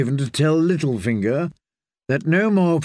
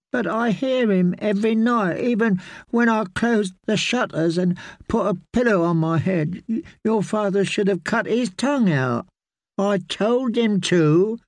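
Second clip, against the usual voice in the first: put-on high child voice, scared and sulky; a haunted boy complaining at night; frightened boy; 7 semitones higher than usual. A narrator's reading.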